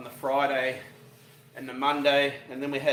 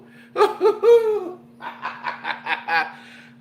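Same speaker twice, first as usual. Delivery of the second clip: loud high laughter; 10 dB louder than usual. Real, not acted. After whoop.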